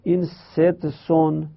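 این ست سون said slowly, ست and سون apart; slow split reading. Shown as a demonstration. (آرام و جدا: ست سون)

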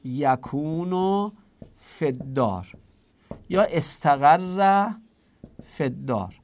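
very slow, word by word: زیدون یکونو فی الدار; dictating the hidden verb slowly to students; slow spelled-out demo. Shown as a demonstration. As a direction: lay out یکونو and استقر slowly for the class.

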